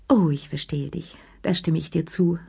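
usual speaking voice

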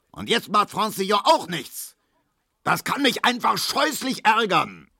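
hohe, erregte Schimpftirade; wütend schimpfend. A narrator's reading. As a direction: schimpf dich in Rage. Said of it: "brüllte Herr Markowski". (Markowski schimpft über Zidorn, hoch und erregt)

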